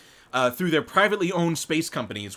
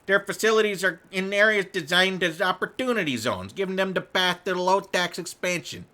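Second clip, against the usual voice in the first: put-on lightly done Chicago accent, higher; 5 semitones higher than usual. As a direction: read it like a Chicago local. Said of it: "I have no reason to do a Chicago accent".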